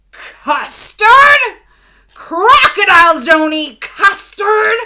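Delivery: high, very loud crocodile shout. A narrator's reading. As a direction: roar it at the cooks. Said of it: "In a louder and fiercer voice".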